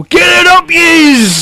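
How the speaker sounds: high, drawn-out terrace holler; mock football-fan taunt. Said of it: "turn on and go".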